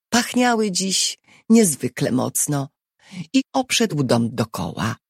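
plain reading voice

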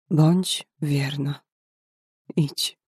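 slow, moved, a hint of a catch in the voice; voice breaking with emotion. A narrator's reading. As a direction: read it slowly, barely holding back tears. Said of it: "łamiącym się ze wzruszenia głosem".

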